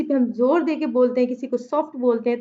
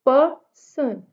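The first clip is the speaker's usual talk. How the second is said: "Person" in two separate beats, पर, सन; split into syllables; much slower than usual. Shown as a demonstration. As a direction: pause between पर and सन in "person". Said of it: "इसमें दो syllables हैं".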